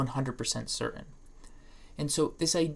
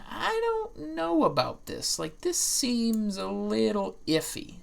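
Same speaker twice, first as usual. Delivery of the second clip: doubtful student; 6 semitones higher, slower than usual. Pretend. Imagined doubtful listener, high slow far.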